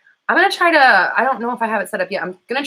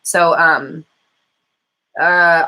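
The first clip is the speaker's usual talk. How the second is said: normal talking voice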